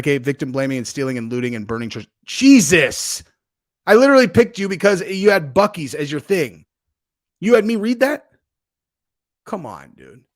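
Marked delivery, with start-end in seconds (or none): 2.3-3.2 loud, exasperated exclamation; real shocked outcry, much louder; appalled outburst. Real, not acted. Shocked by chat message just read. 3.9-10.2 loud, raised-pitch complaint; real exasperation, louder and higher; indignant protest. Real, not acted. Scolding the chatter after reading it.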